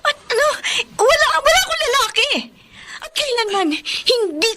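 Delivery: wife angrily denying husband's charge; indignant denial. Acted. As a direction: fire back at the accusation. Wife accused of cheating.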